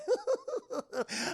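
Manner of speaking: very high Joker-style giggle; villain cackle. Acted. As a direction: cackle in a high-pitched staccato. Acting Joker's laugh.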